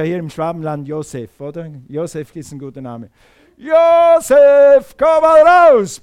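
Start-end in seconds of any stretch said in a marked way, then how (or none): 3.6-6.0 very high, drawn-out shout; slow, stretched summoning yell at full volume; loud calling out. Acted. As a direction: bellow a name across a graveyard. Imagined calling dead Josef from grave.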